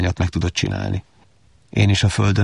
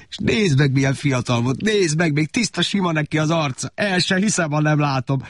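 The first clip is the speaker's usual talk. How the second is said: amazed; 8 semitones higher than usual. Acted. Worker marvels at photo.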